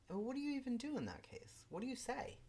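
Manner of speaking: high, fast, exasperated questions; throwing up her hands at the situation. Real, not acted. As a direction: listener baffled by police lies, asking in high exasperation.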